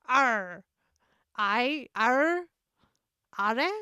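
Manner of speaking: a pirate's drawn-out "Arr", tried out; tentative, badly faked pirate. Shown as a demonstration. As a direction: test out a pirate growl on "Arr". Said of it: "the quotation in pirate voice".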